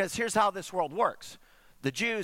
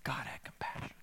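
whisper; 11 dB quieter than usual. Acted. Dramatic hush on God's mercy.

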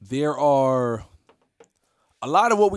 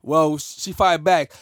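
ordinary talk

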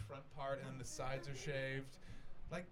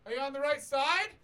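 high loud call-out; calling across street; 12 semitones higher, 12 dB louder than usual. Retold or imitated. Comic calling across to a drunk girl, loud. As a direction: call over the traffic to her.

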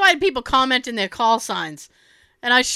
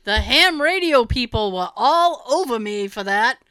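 usual speaking voice

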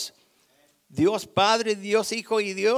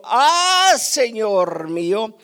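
acted cry out; 8 dB louder, slower than usual. Preacher voices the young man who lost the axe, acted cry out. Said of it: "y gritó diciendo".

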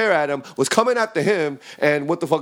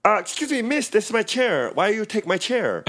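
lightly voiced cook, higher; a hint of the offended cook, higher; mock Taku voice; 5 semitones higher than usual. Taku complains to the girl.

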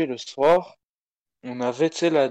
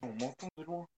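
distant, much softer off-mic voice; far from mic; 3 semitones higher, 16 dB quieter than usual. Real, not acted. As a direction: speak from across the room. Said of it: "on t'entend de loin un peu".

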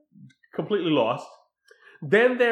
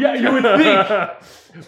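laughing; 5 semitones higher, 8 dB louder, much slower than usual. Real, not acted.

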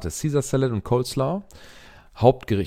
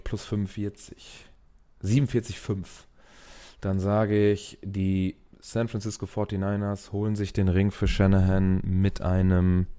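ordinary talk, nothing marked

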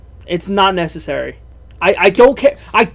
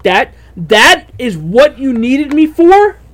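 slow, louder, higher disbelieving question; retold exasperation, dragging out the words; incredulous; 5 semitones higher, 5 dB louder, slower than usual. Acted disbelief at dad's summons.

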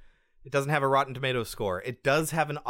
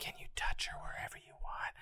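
breathy unvoiced whisper, much softer; mock creepy whisper; 13 dB quieter than usual. Mocking the patient who beckons from behind the curtain, whispered. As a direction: beckon in a spooky hiss. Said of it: "whispering to me like that".

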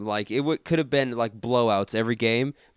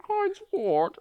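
mock villain voice; 4 dB quieter than usual. Mock villain line, very high.

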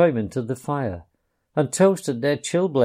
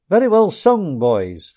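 hearty, higher and louder praise; hearty Rat; 7 semitones higher, 7 dB louder than usual. A narrator's reading. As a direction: cheer them like a jolly host. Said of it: "cried the Rat heartily".

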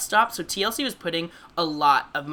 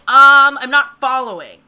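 a loud, high, sarcastic "um", then dry disbelief; unimpressed deadpan; 6 semitones higher, 14 dB louder, slower than usual. Pretend. Host to cohost, pretending to react to a smaller sequel, mock baffled. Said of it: "I'd be like".

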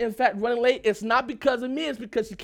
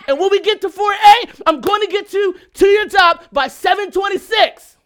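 higher, loud, fast, laying it all out; loud lecture; 6 semitones higher, 9 dB louder than usual. Retold or imitated. Husband shouts his route plan at his wife, loud and fast. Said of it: "I snapped".

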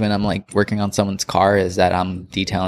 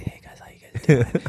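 ASMR whisper; 3 semitones higher than usual. Shown as a demonstration. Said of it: "A S M R".

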